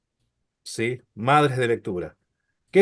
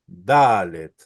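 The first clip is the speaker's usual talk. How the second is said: Dalet with the first A drawn out and stressed; 7 semitones lower than usual. Shown as a demonstration. Teacher shows students where the accent falls in Dalet, stress on the A. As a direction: lean on the A of Dalet. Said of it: "el acento va a estar en esta A".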